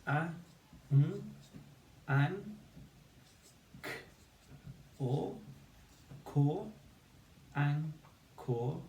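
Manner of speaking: anchor built in high, separated sounds; anchor sounded out. Shown as a demonstration. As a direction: split anchor into an and kor, full or vowel. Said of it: "two syllables".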